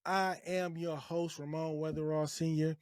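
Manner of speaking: usual speaking voice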